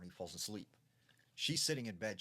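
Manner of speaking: plain reading voice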